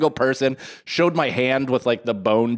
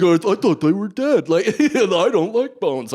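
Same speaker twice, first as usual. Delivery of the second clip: higher, louder, slowed voices from memory; louder, higher echo of "I don't like bones" in the mind; 7 semitones higher, 4 dB louder than usual. Retold or imitated. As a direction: replay the voices haunting your head. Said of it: "everything floating in my fucking head".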